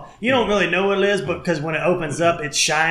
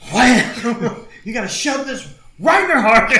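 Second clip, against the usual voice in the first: higher-pitched mock frantic movie quote, half laughing; raised, laughing mock urgency; hammed-up panic; 7 semitones higher, 4 dB louder, slower than usual. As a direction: act out a panicky order, amused. Acting out frantic adrenaline-shot scene.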